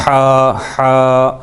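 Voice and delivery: ہا squeezed tight in the throat, held long; drawn-out constricted h in ہا; pinched hard h. Shown as a demonstration. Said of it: "pinching of sound ہوتی ہے".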